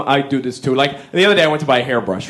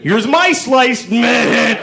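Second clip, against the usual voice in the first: slow mock shout, higher pitch; shouted boast; 7 semitones higher, much slower than usual. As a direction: drag it out and shout it proudly, pitched up. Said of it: "he goes".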